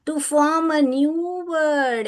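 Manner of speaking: drawn out very slowly; slow teacher dictation. Shown as a demonstration. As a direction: read it slowly for children to follow. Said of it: "एक नया शब्द उत्पन्न होता है".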